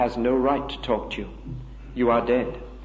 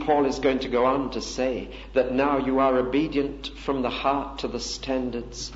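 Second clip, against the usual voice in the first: usual speaking voice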